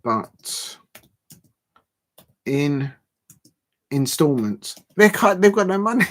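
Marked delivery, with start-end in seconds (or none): 5.0-6.1 high, loud, laughing disbelief. Real, not acted. Streamer mocking the club's finances, laughing. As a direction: crack up while saying it.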